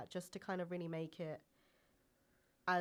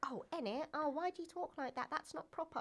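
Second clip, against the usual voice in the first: high, prissy mock of a snob; mocking posh colleague; 10 semitones higher than usual. Mocking a posh colleague who scolds her slang, high and prissy. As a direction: look down your nose at the slang. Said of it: "But they'd be like".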